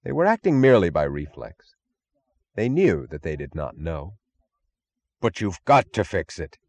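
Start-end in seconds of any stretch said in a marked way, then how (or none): 5.2-6.6 mild yell. Acted. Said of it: "yelled Ferris".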